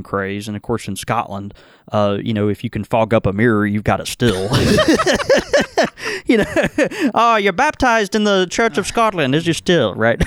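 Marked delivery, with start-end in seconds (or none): 4.5-6.2 high laugh. Acted. Laughing before Irish accent bit. 7.1-10.2 put-on lightly Irish accent; a touch of an Irish accent. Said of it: "This is my Irish accent in Scotland".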